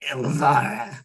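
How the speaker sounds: rasped, half-whispered name; hoarse whisper. Acted. Scarred legion woman introduces herself in a hoarse whisper. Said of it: "very whisper, very hoarsely".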